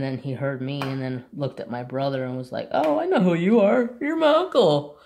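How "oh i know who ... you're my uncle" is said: very high, loud, gushing dog talk; excited dog voice. Acted. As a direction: voice an overjoyed dog recognizing family. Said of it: "And then got all excited".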